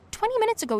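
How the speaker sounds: high, loud indignation; indignant reproach. A narrator's reading. Girl reproaches Colin for insulting her, indignant. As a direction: pitch leaps up in outrage.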